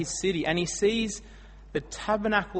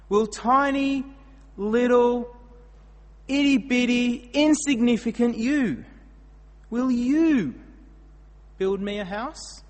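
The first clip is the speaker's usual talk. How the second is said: higher and louder, leaning hard on "you"; emphatic build on you; 7 semitones higher, 6 dB louder than usual. Shown as a demonstration. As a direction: shrink the listener, then hit "you". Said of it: "The emphasis is on you".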